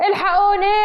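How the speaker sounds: high, loud scream for help with a laugh in it; panicked cry for help. Acted. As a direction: yell for rescue from the car roof. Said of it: "وبيصرخ باستنجاد".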